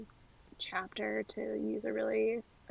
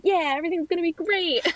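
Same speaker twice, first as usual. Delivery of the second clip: naive optimism; 7 semitones higher, 6 dB louder than usual. Retold or imitated. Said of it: "being like".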